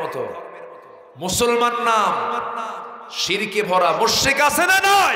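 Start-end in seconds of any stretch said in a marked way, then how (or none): 1.2-5.2 slow, high, loud preaching cry; preaching shout. Real, not acted. Preacher challenging the gathering, slow loud shout. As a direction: drag it out and hurl it at the crowd.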